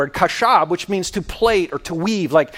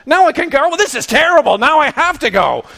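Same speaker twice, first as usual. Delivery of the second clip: mock reluctant Moses, pitched higher and louder; Moses whining; 6 semitones higher, 5 dB louder than usual. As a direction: act out Moses grudgingly giving in. Moses retold complaining to God.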